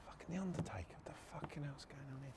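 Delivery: whispered, incredulous inner thought; near-silent exasperated whisper; muttered disbelief. Retold or imitated. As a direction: mutter it privately under your breath. Muttered thoughts while working.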